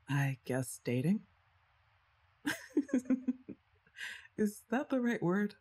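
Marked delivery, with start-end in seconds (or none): 2.4-3.5 a high, embarrassed little laugh; a nervous giggle; shy laugh. Real, not acted. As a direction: laugh off the awkward word. Laughing at the word dating.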